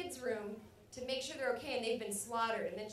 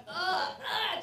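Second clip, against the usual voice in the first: loud, high groans of revulsion; hammed-up squeamish groaning; disgusted ughs; 8 semitones higher, 8 dB louder than usual. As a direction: recoil with two loud ughs. Acts the feeling of stabbing.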